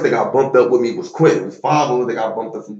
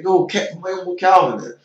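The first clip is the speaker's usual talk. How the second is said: teammate calling out; 9 semitones higher than usual. Retold or imitated. Teammate voiced calling coach.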